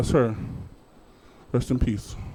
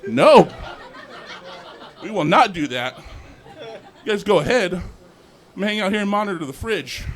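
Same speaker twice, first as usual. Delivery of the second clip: lazy refusal; 7 semitones higher, 4 dB louder than usual. Retold or imitated. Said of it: "I'm like".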